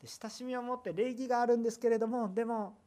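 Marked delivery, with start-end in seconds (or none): none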